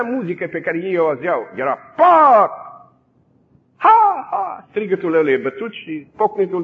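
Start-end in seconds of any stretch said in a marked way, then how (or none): 2.0-4.7 whip crack and shout. Retold or imitated. Imitating the guards beating prisoners.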